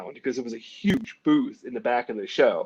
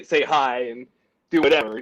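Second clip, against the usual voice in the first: ordinary talk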